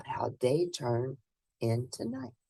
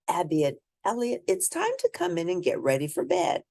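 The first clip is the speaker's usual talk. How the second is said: ordinary delivery, nothing marked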